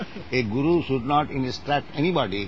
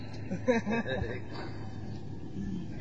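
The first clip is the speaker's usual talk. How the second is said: long, quiet, high chuckle; soft chuckling; 5 semitones higher, 11 dB quieter than usual. Real, not acted. Laughing after disciple admits "that is what I am", soft chuckle. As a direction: chuckle softly to yourself.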